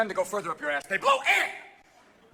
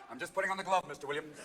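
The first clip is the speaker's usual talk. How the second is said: calm doctor; 5 semitones lower, 8 dB quieter, faster than usual. Pretend. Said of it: "first time it's".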